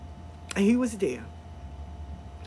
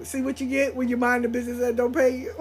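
ordinary delivery

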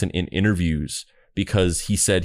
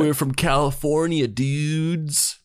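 higher, surfer-dude American voice; fake American accent; 7 semitones higher, slower than usual. Quoting the duo's stage line in a fake American accent. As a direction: drawl it like a California dude. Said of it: "You don't have to do it in the accent".

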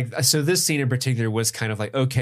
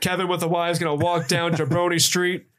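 higher-pitched wrestler trash talk; The Rock; 4 semitones higher than usual. Acted. Cop bragging in third person, wrestler swagger. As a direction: swagger through it like a wrestling promo. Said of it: "He starts talking like The Rock from W W E".

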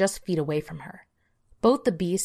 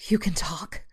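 shocked whisper. Acted. Startled girl, whispered.

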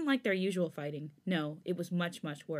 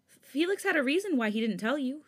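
calling out; 7 semitones higher, 5 dB louder than usual. A narrator's reading. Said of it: "Hyunjin finally called out".

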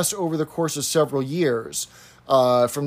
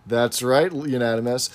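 ordinary talk

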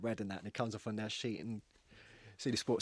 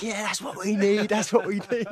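high, loud delighted coach voice with a laugh; excited cheer; 11 semitones higher, 12 dB louder than usual. Retold or imitated. Coach cheering the player for smashing youngsters, loud and delighted. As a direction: cheer like a coach loving the tackles.

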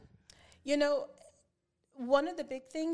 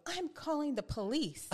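slow, higher-pitched mock threat; threatening son; 6 semitones higher than usual. Son threatening his mom over a swat, mock defiant. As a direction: imitate a kid announcing a threat, drawn out. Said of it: "Jeffrey telling me".

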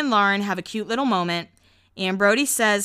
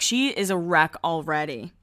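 normal talking voice

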